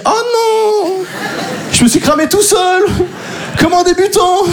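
high, laughing "Oh non!" panic; mock panic. Comedian realising he outed himself, laughing panic. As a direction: wail inwardly at getting caught. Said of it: "je me dis".

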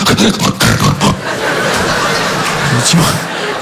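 odd sputtering engine laugh; date's laugh like a Clio engine; mock weird laugh. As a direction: imitate a strange rising laugh. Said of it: "elle fait".

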